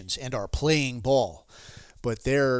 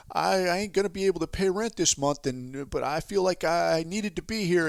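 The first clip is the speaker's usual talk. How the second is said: higher, put-on hard-up follower; earnest caricature in a raised voice; imitated seminar attendee; 5 semitones higher than usual. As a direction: mimic a humble believer confessing money trouble. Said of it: "people are walking up and talking about".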